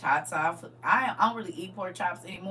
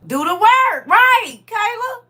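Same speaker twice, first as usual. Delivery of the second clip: loud, higher, pressing the point home; emphatic loud lecture; 8 semitones higher, 11 dB louder, slower than usual. Real, not acted. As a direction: hammer the message at the listener. Lecturing caller to do work.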